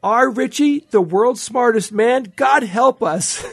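high, slow, loud-ish mock disbelief of a mother; exasperated mother. Quoting Feynman's mother on his title, exasperated mother. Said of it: "his mother said".

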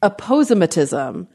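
aposematism said uh-POZE-uh-muh-tism; long O in the stressed POZE of aposematism; personal mispronunciation. Shown as a demonstration. Host showing how she wants to say it, personal mispronunciation. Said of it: "the way I want to say it is".

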